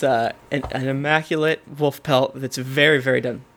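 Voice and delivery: ordinary talk, nothing marked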